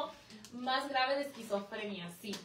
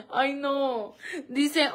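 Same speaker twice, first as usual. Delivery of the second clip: ordinary talk, nothing marked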